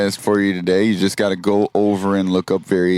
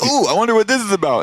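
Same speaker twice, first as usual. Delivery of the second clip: eager curiosity; 10 semitones higher, much faster than usual. Retold or imitated. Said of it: "You're like".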